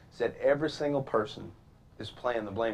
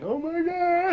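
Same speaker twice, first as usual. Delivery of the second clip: mock panic; much slower than usual.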